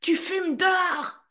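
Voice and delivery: high, cried-out protest; shouted. Retold or imitated. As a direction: yell it like a kid stamping his foot. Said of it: "j'ai crié".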